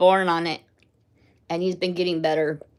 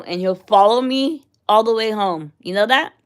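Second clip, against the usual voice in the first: usual speaking voice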